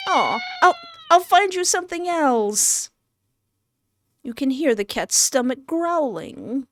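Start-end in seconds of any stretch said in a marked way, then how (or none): 0.1-2.9 high-pitched pet-owner coo; cooing reassurance. Pretend. Player consoling the hungry kitty, high cooing reassurance.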